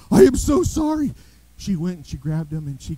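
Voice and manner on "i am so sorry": apologetic clerk. Retold or imitated. Lady apologizing at window.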